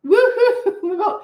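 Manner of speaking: high whooping reply through a laugh; yelled back woohoo. Retold or imitated. Stranger answering her cheer across the parade, laughing whoop. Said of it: "someone else yelled back".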